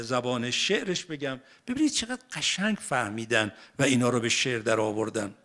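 ordinary talk, nothing marked

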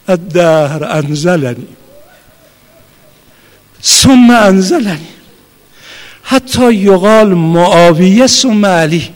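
slow, weighty Arabic recitation. Real, not acted. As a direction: let each phrase fall heavy and drawn out. Reciting Ali's lament. (تلاوت کند و سنگین)

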